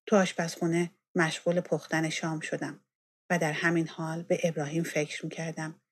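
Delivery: ordinary delivery, nothing marked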